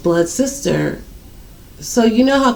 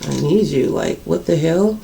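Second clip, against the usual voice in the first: disbelief; 4 semitones lower, 7 dB quieter than usual. Retold or imitated. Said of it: "I'm like".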